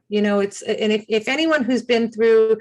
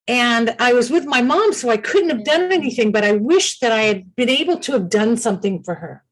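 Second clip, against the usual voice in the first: ordinary talk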